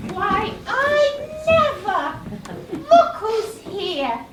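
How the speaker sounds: high, slow exclamation of surprise; drawn-out delighted gasp of surprise; mock surprise. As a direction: act astonished at the door. Surprised by doorbell visitor.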